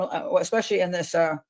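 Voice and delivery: ordinary talk, nothing marked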